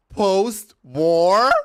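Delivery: loud, high incredulous cry; shouted disbelief. Real, not acted. Vlogger mocking friend's idea of Berlin, shrieking disbelief.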